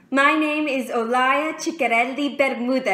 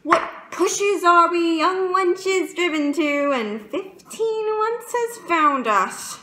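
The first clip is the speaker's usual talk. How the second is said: higher, louder outburst; worked-up lament; 6 semitones higher, 3 dB louder than usual. Acted. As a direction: lament it with raised pitch and volume. Monologue, lamenting lovesick young wenches.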